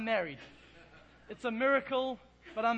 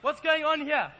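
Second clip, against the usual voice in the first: bewildered loud outburst; 3 semitones higher, 8 dB louder than usual. Retold or imitated. Baffled by the tire parts.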